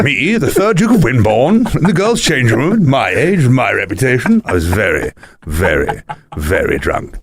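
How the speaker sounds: put-on posh drunk duke, drawing out the last "very"; pompous aristocrat. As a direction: play the disgraced duke owning up. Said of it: "the guy that was like".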